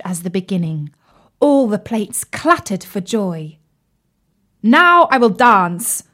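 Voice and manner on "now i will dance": loud, high proud announcement; excited. Acted. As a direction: announce the dance with pride. Fire tongs boast.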